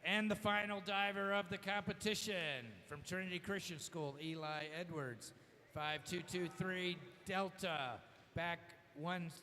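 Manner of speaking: announcer over the pool PA; echoing PA announcement; diving announcer echo. Real, not acted. PA announcer reads scores and dive.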